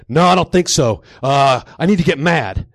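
loud, insistent gym psych-up; psyching himself up. Acted. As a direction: work yourself up to get mad. Acted gym psych-up in story.

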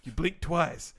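slow, low seductive narration; sultry story narration. Acted. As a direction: read it like a steamy romance.

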